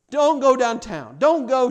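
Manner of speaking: high, loud desperate begging; pleading grandmother. Acted. Grandmother begging her grandson, loud and high. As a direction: beg him not to leave, loudly and high. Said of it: "begging me and pleading with me".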